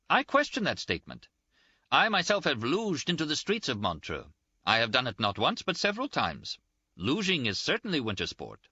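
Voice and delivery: high, emphatic official indignation; indignant insistence. Acted. Official defending Montreux winter sport, high indignant insistence.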